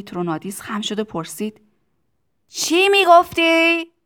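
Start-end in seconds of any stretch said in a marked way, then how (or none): 2.5-3.9 high, drawn-out yell; shouted question. A narrator's reading. Factory owner bending down to hear Tistu, shouting. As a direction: holler slowly over the racket.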